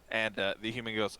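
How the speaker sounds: normal talking voice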